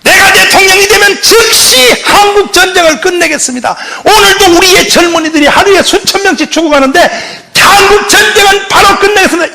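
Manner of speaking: rally the crowd like a candidate; campaign shout. Retold or imitated. US candidates promise ending war. (높고 힘찬 유세 외침)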